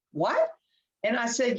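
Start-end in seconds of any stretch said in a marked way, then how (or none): 0.1-0.5 retold disbelief.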